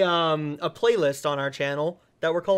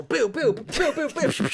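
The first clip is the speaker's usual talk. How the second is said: rising playful boos; mock booing; 9 semitones higher than usual. Host booing his own special title. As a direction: jeer the title in fun.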